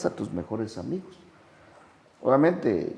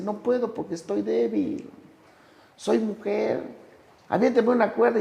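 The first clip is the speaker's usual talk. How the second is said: much higher-pitched imitated excuse; voiced weak nurse; 11 semitones higher than usual. Nurse looking into the pit refuses to help, high-pitched acted excuse. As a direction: raise the pitch to play a woman begging off.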